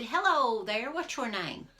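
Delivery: loud friendly hail, up high; calling out hello. A narrator's reading. Jack hails the man on the hill, loud and friendly. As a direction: call across to him.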